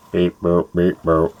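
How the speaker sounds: monotone toy-robot beep-boop; mock robot beeps. Host playing a weird Star Wars walker, robotic monotone. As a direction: beep like a little walking machine.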